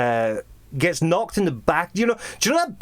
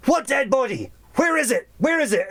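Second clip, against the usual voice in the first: high, urgent demanding voice; raised-pitch, alarmed questioning; acted boss; 10 semitones higher than usual. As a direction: demand answers as an agitated boss. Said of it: "he starts heading out and he says".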